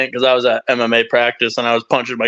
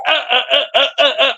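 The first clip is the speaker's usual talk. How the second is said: string of high, quick "uh" noises; imitated grunts; 10 semitones higher, much faster than usual. Fighter grabbing the guy's head, imitated high grunting. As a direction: act out frantic repeated grunts.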